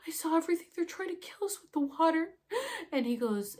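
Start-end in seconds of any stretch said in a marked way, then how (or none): 0.1-2.4 crying. Retold or imitated. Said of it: "and I start crying".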